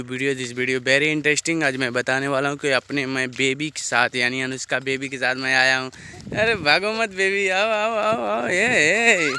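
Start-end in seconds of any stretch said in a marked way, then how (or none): 6.4-9.3 higher-pitched coaxing call. Real, not acted. Vlogger to baby running off, calling after her.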